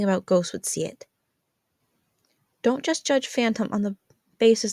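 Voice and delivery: put-on teenage girl earnestly persuading; Jazz pleading. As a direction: coax Mom to see it your way, voice lifted. Jazz keeps pleading with mom.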